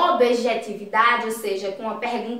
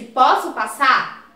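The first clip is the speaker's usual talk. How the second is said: slow, firm direct question; 5 dB louder, slower than usual. Shown as a demonstration. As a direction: ask it straight out, each word clear. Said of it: "Eu simplesmente disse".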